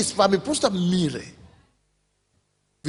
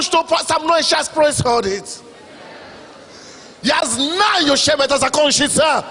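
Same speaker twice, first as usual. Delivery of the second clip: preaching at full force; 10 semitones higher, slower than usual. Acted. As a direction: proclaim it to the hall.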